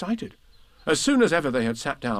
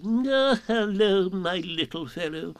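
kindly; 7 semitones higher, slower than usual. Acted. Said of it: "in a kindly way".

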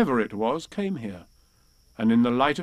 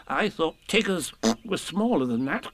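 small, higher, timid creature voice; Piglet; 4 semitones higher than usual. A narrator's reading. Piglet wary of Tigger's size, small and timid. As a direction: speak up hesitantly as a little piglet. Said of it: "said Piglet".

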